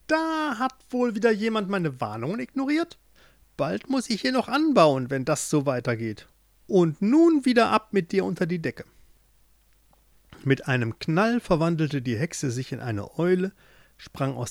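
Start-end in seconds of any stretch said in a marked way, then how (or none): 0.1-8.9 put-on cackle-toned old witch sneering at her captive. A narrator's reading. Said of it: "Sie rief". (hohe, hämische Hexenstimme)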